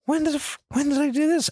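a startled, incredulous double take; shocked disbelief. Real, not acted. Podcast host stunned by a game result, high disbelief. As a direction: pitch jumps high in sudden disbelief.

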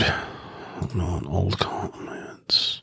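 under-the-breath whisper, slow; barely voiced mumble while searching; whispering to himself. Real, not acted.

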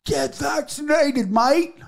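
high, slow, drawn-out Australian holler; mock Aussie heckle. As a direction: yell it like a rowdy Australian fan in the stands. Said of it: "hollers out".

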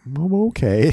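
a hint of a sheepish boy; sheepish little boy. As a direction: answer like a caught little kid. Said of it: "I'm like".

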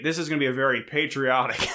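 normal talking voice

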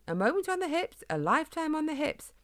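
high, lilting mimicry of a wife; mother's saying. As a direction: recite a nagging motto in mock-feminine pitch. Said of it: "Your mother always says".

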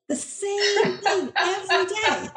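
laughing it out high and slow; real laughter through the words; words dissolving into giggles. Real, not acted. Laughing at the drunk statue memory.

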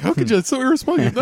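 mock outrage. Mocking scolding onlookers.

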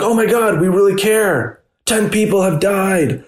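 high-pitched, exaggerated show of sudden concern; put-on shock, pitched well up; mock alarm. Reporter mimicking sudden public concern over deaths, mock alarm. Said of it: "now we're like".

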